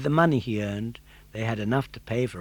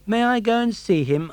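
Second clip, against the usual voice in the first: put-on puppet boy voice; 10 semitones higher, 6 dB louder than usual. Pinocchio voiced in story.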